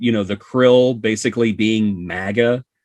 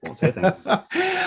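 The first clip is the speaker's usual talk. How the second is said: high, slow, giggling through the words; laughing promise; 13 semitones higher, 3 dB quieter, slower than usual. Real, not acted. Co-host promising no spoilers, laughing high. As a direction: tease them while laughing.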